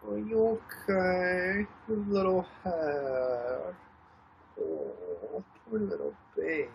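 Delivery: slow, soft, drawn-out pet talk. Real, not acted. Owner comforting her hurt dog, slow soft baby talk. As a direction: linger over each word to calm a pet.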